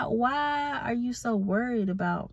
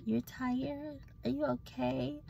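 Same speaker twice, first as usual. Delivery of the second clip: higher, softer mock-sweet concern; 6 semitones higher, 8 dB quieter, slower than usual. Mocking coworkers who fuss over her, softer and higher mock concern. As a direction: parody fake worry, a little higher and softer. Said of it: "talking about some".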